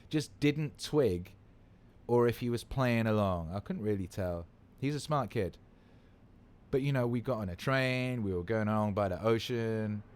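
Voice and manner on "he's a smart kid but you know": ordinary talk, nothing marked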